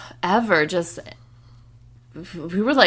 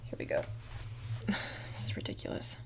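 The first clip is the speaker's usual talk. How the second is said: whispering; 19 dB quieter, faster than usual. Real, not acted. Hushed aside while playing evidence.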